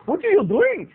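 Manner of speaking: high-pitched mock Indian accent; agitated shop owner in an Indian accent. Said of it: "I'm giving this guy an Indian accent".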